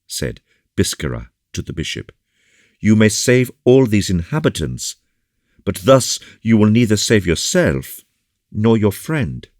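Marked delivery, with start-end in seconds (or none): 2.8-9.5 higher, louder warning voice; raised, pressing appeal; earnest officer. A narrator's reading. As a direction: warn them urgently, pitched up. Biscarat warns the bishop.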